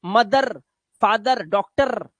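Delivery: मदर, फादर, डॉक्टर each with a rolled final r; wrong sounded r. Shown as a demonstration. Teacher warns against these, final r rolled. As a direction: roll the r ending every word. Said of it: "मत करना".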